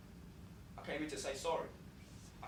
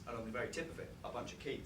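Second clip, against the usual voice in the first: ordinary talk, nothing marked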